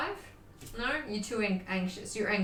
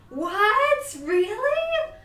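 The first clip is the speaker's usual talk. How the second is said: high, loud startled question; genuinely taken aback; shocked; 12 semitones higher, 5 dB louder, much slower than usual. Real, not acted. Streamer reacts to chat's warning, shocked.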